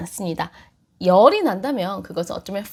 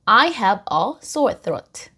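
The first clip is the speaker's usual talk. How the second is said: very slow, every word clear; slow model sentence; much slower than usual. Shown as a demonstration. Teacher models the sentence slowly for students. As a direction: say it slowly for learners to repeat. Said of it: "그래서".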